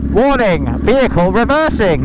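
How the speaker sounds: automated truck announcement; flat robot safety voice; robotic warning voice. Retold or imitated. Reversing announcement voice.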